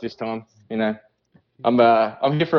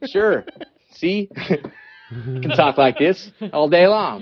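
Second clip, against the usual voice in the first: put-on slow American drawl; American accent; 3 semitones higher, slower than usual. Shown as a demonstration. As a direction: show off a fake American accent. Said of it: "I can speak an American accent".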